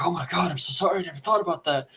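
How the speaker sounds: high-pitched mock pleading of a cornered debtor; panicked apology. Game player imitating a threatened debtor who hands over money, mock panic. Acts out frightened debtor giving in.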